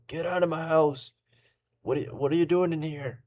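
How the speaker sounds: put-on high, startled old man shouting at intruders; a frail old man ordering strangers out of his house; old homeowner confronting raiders; angry old man. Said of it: "The old man is like".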